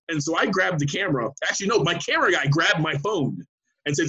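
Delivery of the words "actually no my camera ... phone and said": normal talking voice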